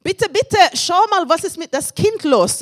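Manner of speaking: begging in mild alarm; mother's plea. Retold or imitated. Mother begging the aunt to check the silent baby, high and urgent. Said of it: "sie sagt, etwas ist nicht in Ordnung".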